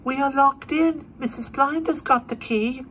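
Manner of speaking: put-on small child behind a locked door; little shrill voice. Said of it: "a little shrill voice inside said".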